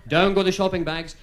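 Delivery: ordinary talk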